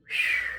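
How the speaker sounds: loud blown-out breath; whew. Real, not acted. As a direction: blow out a big "whew". Relief at giving up favourite foods.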